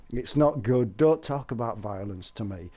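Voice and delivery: ordinary talk, nothing marked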